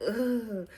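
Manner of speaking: loud, high exasperated "ugh"; frustrated groan. Retold or imitated. Coach venting about cheerleaders who want yelling, frustrated groan.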